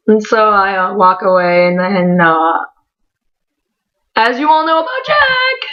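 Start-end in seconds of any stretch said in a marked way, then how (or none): 4.1-5.7 pitched way up, squeaky-high; raised voice. Real, not acted. Fan telling viewers about a favorite, voice raised high. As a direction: send the voice up high on purpose. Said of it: "my voice raised on purpose".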